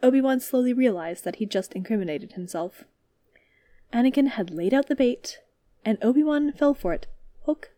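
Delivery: usual speaking voice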